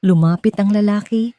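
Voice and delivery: ordinary delivery, nothing marked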